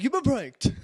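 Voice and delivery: high, loud gotcha announcement; triumphant mock announcement; gleeful prank reveal. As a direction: crow it out, springing the prank.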